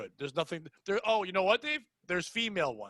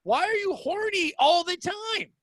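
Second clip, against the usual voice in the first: high, drawn-out incredulous quote; exasperated disbelief; 8 semitones higher, slower than usual. Retold or imitated. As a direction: stretch the words in mock disbelief.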